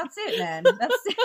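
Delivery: high-pitched laughing agreement; real laughing. Real, not acted. Co-host agreeing the mullet explains the trauma, laughing. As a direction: crack up while agreeing. Both cracking up over mullet.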